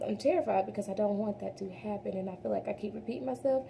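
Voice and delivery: ordinary talk, nothing marked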